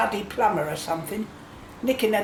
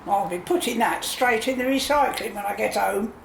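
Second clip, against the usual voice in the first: normal talking voice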